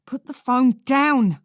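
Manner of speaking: slow, loud high command; shouting. Acted. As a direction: shout it at him, slowly. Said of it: "I shout".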